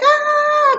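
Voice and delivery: high thin squeal; imitated crowd scream. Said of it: "Everyone freaked out".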